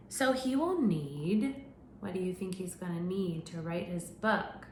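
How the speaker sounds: animated picture-book narration; reading to children. Acted. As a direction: read it out to a class of little kids. Reads picture book to class.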